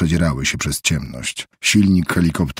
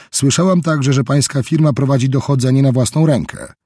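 higher, cool official woman's voice; smooth veiled pressure; Roberts voiced; 7 semitones higher, 4 dB louder than usual. A narrator's reading. Roberts presses Helge on the phone, higher cool official voice.

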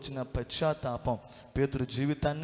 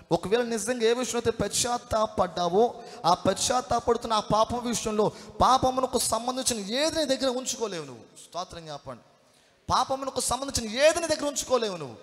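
high, loud, insistent sermon delivery; full-force preaching; 8 semitones higher, 6 dB louder than usual. Real, not acted. Preacher warning that sin cannot be kept, full-force preaching. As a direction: drive it home to the congregation at full pitch.

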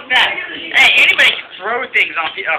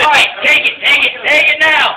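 urgent shouting; 6 semitones higher, 5 dB louder than usual. Real, not acted. Urging someone to take photo.

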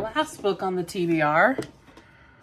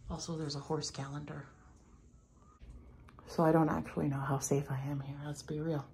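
whispering; 8 dB quieter than usual. Real, not acted. Whispering in doctor's office.

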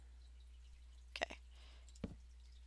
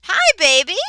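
squealed greeting; 11 semitones higher, 18 dB louder than usual. Real, not acted. Greets a creeper in game.

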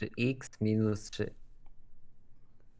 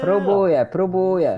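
higher, louder pleading "I'm trying"; raised, harried voice; flustered protest; 6 semitones higher, 6 dB louder than usual. Real, not acted. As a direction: protest loudly under pressure. Protests while working under pressure.

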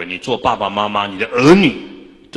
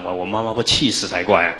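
ordinary talk

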